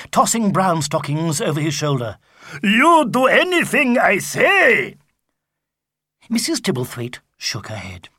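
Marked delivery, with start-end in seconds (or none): 2.6-5.0 higher, louder, slow Viking order; a brute giving commands; bossy Viking. Acted. Sigurd voiced.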